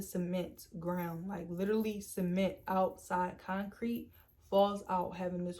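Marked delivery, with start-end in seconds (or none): none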